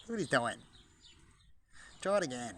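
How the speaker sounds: ordinary talk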